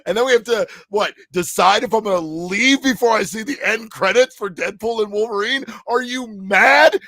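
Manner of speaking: high, loud theatrical indignation; mock outrage. Podcast host defends staying through movie credits, in mock outrage. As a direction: exclaim as if the very idea is absurd.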